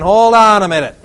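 loud, high call to stop; alarmed shout. Acted. Preacher objecting to God, loud alarmed shout. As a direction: shout it in protest. Preacher imagines his protest.

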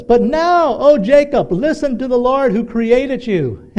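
high, loud prophetic proclamation; proclaimed scripture reading. Acted. Preacher reading Isaiah to congregation, high ringing proclamation.

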